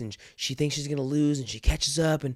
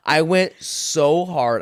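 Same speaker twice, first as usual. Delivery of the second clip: normal talking voice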